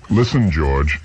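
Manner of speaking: usual speaking voice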